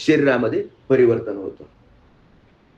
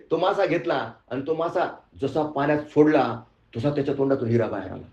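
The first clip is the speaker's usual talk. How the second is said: ordinary talk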